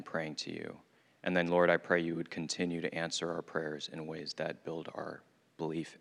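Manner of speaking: soft, low, earnest prayer voice; hushed closing prayer, unhurried. Acted. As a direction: pray it quietly to God. Opening prayer before the talk.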